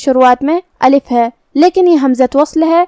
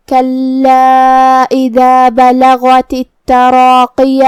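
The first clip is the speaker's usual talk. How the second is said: Quran recitation; much slower than usual. Shown as a demonstration. Teacher recites the verse for students, slow chant. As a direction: chant the verse slowly, each letter clear. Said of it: "ہم زبر ظاہر کریں گے".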